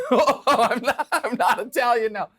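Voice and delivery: laughing, giving in. Real, not acted. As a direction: laugh the words out. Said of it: "laughing concession".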